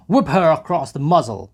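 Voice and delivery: loud, high order; brutal shout. A narrator's reading. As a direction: bellow it at the lads. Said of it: "shouted Mikolka".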